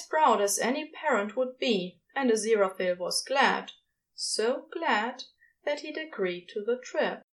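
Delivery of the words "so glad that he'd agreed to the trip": ordinary delivery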